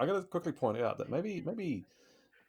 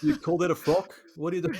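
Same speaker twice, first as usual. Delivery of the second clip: high, loud mock-scolding tyrant; mock tyrant outrage; 8 semitones higher, 11 dB louder than usual. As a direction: bellow it like a tyrant mocking a frock. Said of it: "Ivan the Terrible quotes".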